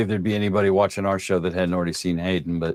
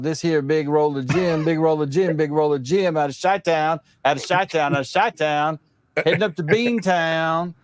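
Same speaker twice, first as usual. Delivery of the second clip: faux Southern accent; 7 semitones higher than usual. Mocking how locals on CB all talk, exaggerated Southern accent. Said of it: "faux Southern accents".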